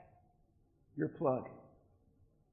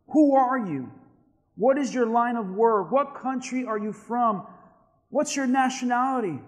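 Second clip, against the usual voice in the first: higher, louder rapid-fire interrogation; demanding; 6 semitones higher, 6 dB louder than usual. Acted. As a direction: fire each question at him, acting the demand. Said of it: "he demanded".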